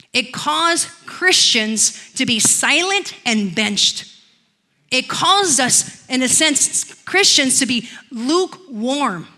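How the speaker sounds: slow, deliberate, weighty words; slow emphasis. Real, not acted. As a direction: drag each word out for weight. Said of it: "slow weighty indictment".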